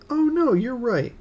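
high, slow surprised admission. Real, not acted. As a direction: caught out and conceding. Admits he was wrong.

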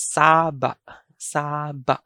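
saba as SAA-ba', short stopped end; long first syllable in saba. Shown as a demonstration. Teacher gives the alternate pronunciation, saba with long SAA. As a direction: stretch the first vowel of "saba", cut the ba short. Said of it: "the alternate pronunciation for saba".

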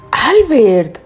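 high, loud shocked rebuke; scandalized scolding. Acted. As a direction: cry the name in indignant reproach. Scandalized wife scolds Albert.

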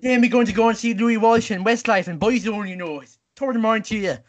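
badly faked Irish-Australian accent; Irish-Australian accent mash-up. Contestant milking the challenge for the host, hammed-up Irish-Australian accent. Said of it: "Irish accent crossed with an Australian accent".

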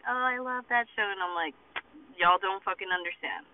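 ordinary talk

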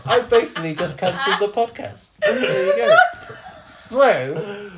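can't stop laughing at his own gaffe; helpless laughter. Real, not acted. Joking to his co-hosts about editing, laughing till near tears.

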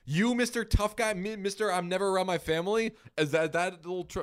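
high-pitched indignant dressing-down; mock scolding. Wife to husband who is never around, mock scolding. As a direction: take him to task in mock outrage.

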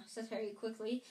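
ordinary delivery, nothing marked

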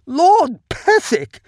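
very high, loud, slow plaintive call; plaintive cry. A narrator's reading. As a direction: cry out over the chaos. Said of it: "the Count's plaintive voice rises".

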